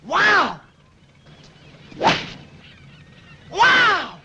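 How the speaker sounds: loud, high amazed wow; amazed shout. Real, not acted. Amazed by golf shot.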